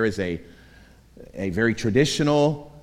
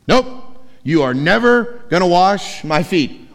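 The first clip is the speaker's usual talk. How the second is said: louder, higher flat refusal, stress on never; Peter's blunt refusal; 6 semitones higher, 7 dB louder than usual. Acted. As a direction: refuse him outright, leaning on never. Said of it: "Peter once again opens his mouth".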